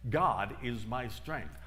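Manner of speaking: slow whispered-in-spirit mantra, one step per phrase; slow affirmation. Retold or imitated. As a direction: repeat it like a step-by-step prayer. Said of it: "And her affirmation was".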